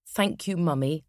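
usual speaking voice

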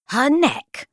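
high, affronted echo; indignant. A narrator's reading. Book interrupting Dad, indignant. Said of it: "Good Manners repeated indignantly".

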